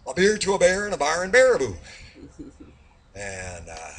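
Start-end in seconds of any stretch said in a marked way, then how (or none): 0.1-1.8 high, fast run through "beer to a bear"; emphatic tongue-twister retake. Acted. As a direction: rattle it off high and triumphant. Retakes the tongue twister after stumbling.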